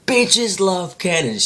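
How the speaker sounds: high, slow, drawn-out catchphrase; mock battle cry. Vampire girl about to fire the cannon, mock battle cry. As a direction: draw it out high as a put-on sniper girl. Vampire girl's line before firing.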